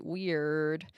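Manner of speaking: "weird" with a drawn-out American R; extra R. Shown as a demonstration. Showing viewers their American-influenced accent, "weird" with an extra R. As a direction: lean slowly into the R in "weird". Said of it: "with extra R on the R".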